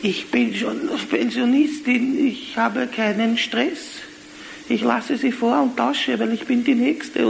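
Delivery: put-on high, slow, sweet little old lady; sweetest old lady voice. Old pensioner sweetly offers the comedian her place, high and slow. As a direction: coo it like a gentle pensioner. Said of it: "sagt mit der süßesten Stimme".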